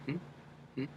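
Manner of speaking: quick questioning hmm from the brow; little flicked hmm. Shown as a demonstration. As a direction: flick the hum up. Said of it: "so it's a little flick".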